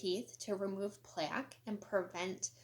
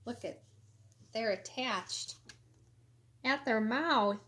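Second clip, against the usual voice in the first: ordinary delivery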